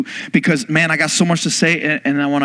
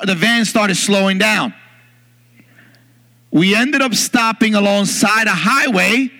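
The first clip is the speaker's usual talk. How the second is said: ordinary delivery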